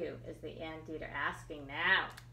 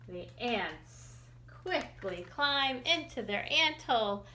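ordinary delivery, nothing marked

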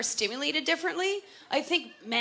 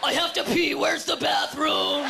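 comic head voice; 3 semitones higher than usual. Pretend. Woman mocking how she talks in her head, harsh shouted voice. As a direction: shout everyday thoughts in a crude put-on voice. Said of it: "I just sound like me".

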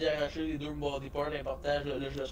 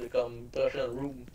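ordinary delivery

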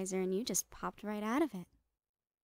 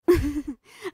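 manic laugh; 4 semitones higher, 10 dB louder than usual. Acted. Laughs while recalling past.

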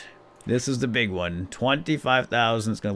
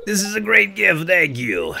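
higher, louder grateful reply; character voice; 8 semitones higher, 5 dB louder than usual. Acted. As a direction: lightly voice the old man thanking. Voicing Linus receiving gift.

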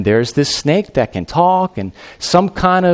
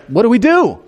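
mock panic; 8 semitones higher than usual. Panicked Adam and Eve.

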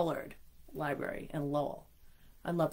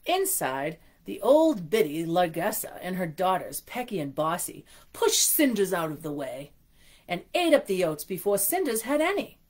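storybook reading voice; story narration; 3 semitones higher, 4 dB louder than usual. A narrator's reading. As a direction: read it like a bedtime story. Reads picture book aloud.

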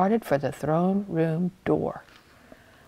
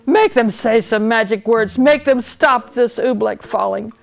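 louder royal order; commanding king; 3 semitones higher, 5 dB louder than usual. A narrator's reading. King orders page to fetch magicians, commanding. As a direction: demand it as a king used to obedience. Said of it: "he commanded".

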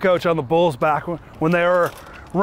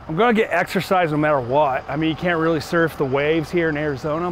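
normal talking voice

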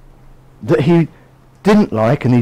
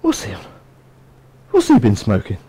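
lower, much softer, baffled aside; God baffled; 7 semitones lower, 4 dB quieter than usual. Pretend. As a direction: mutter it like God rolling his eyes. God listening to Jonah's prayer.

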